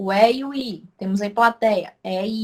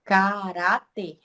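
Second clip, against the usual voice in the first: "Caráter" drawn out slowly in three syllables; slow syllable-split "Caráter"; much slower than usual. Shown as a demonstration. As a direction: stretch "Caráter" syllable by syllable, teacher-style. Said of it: "Temos três sílabas aqui".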